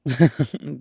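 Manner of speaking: a short, loud burst of laughter; amused laugh. Acted. Amused laugh at listener's suspicion.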